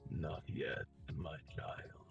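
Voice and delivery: low, gravelly voice from the dark; gravelly sly hobgoblin. Acted. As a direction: speak it slow and low, like a dark god. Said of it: "you hear a gravelly, low voice".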